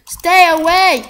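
loud, high order to back off; high, forceful warning shout. Real, not acted. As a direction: yell them away from you. Warning off aliens in the game.

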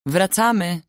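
put-on older sister calling the kids home. A narrator's reading. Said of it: "zawołała do rodzeństwa".

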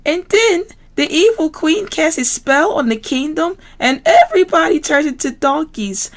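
very high squealy piggy voice; Miss Piggy voice. Acted. Miss Piggy to classmates, very high pig voice. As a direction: play the diva pig, high and bright.

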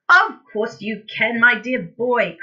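hearty, confident encouragement; mildly excited cry. A narrator's reading. Father insisting his boy can remember, crying out. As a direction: push the boy on loudly. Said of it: "cried Mister Cranky".

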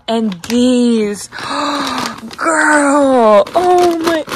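slow, stretched cooing with gasps; gushing pet talk. Real, not acted. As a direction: marvel to your pet, drawing words out. Gushes to pet over treats.

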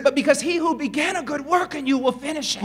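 loud, high preaching build; full-force revival preaching. Acted. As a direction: preach it with rising fire.

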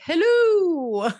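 high, loud chirpy hello; a mock-cheery hello to the accountants; awkward figurehead greeting. Wife arriving at the tax meeting, mock chirpy hello. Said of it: "showing up to the annual tax meeting like".

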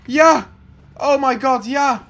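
loud, high cheering; triumphant whoops of relief; jubilant. Real, not acted. Breaks through the door. (uitgelaten gejuich)

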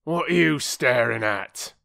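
growled crossly. A narrator's reading. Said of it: "growled the bargee crossly".

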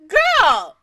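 loud disbelieving call; exasperated shout. Real, not acted. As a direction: yell it at the screen in disbelief. Exasperated cry at the character.

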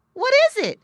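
a small eager animal asking what's wrong; Little Joe Otter asking. Acted. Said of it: "asked Little Joe Otter".

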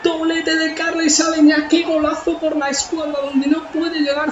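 excited goal call. Real, not acted. Stunning goal just scored.

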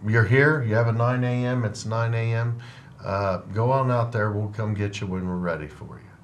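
usual speaking voice

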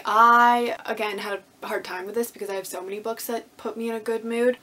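usual speaking voice

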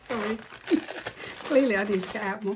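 ordinary talk, nothing marked

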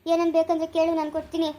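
ordinary talk

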